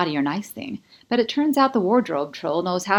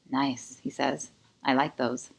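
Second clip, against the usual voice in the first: lower, softer man's voice; 4 semitones lower, 6 dB quieter than usual. A narrator's reading. Kai compliments her pajamas.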